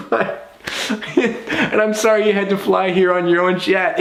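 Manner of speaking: high-pitched, amused retelling; laughing through quote. Real, not acted. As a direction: get the punch line out while laughing. Quotes joke while cracking up.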